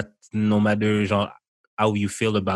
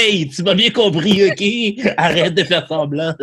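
higher, louder mock scolding, laughing at the end; mock telling off; 9 semitones higher, 4 dB louder than usual. To the guy behind the counter, a hammed-up higher, louder telling off. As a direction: tell him off in jest.

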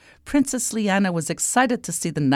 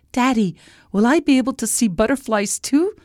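put-on high child's voice; high-pitched hopeful question; hopeful little princess; 6 semitones higher than usual. A narrator's reading. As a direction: ask Daddy like an eager little girl. Princess asks her father hopefully.

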